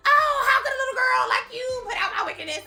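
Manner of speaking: theatrical melting-witch lament, high and laughing; Wicked Witch of the West melting. Retold or imitated. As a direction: ham it up like a cackling witch melting away. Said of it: "it cracks me up 'cause she goes".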